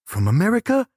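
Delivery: high rising mock question; imitating a French cameraman.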